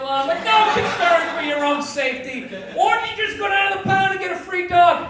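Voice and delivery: comic outraged shout; ranting shout. Acted. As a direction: yell it at the smug owner. Ranting at dog owners.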